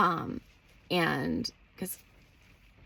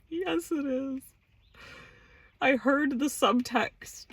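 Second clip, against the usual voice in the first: high, whiny insistence, slowing down; mock petulance; 8 semitones higher, slower than usual. Woman insisting to her partner she heard subtext, petulant. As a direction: insist in a squeaky, hammed-up pout. Acting out her stubborn comeback.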